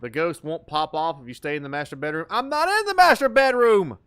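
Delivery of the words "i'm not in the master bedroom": louder, higher indignant retort; loud protest. Real, not acted. Player snapping back at the game voice, loud. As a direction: shout back that you're not there. Retort during ghost hunt.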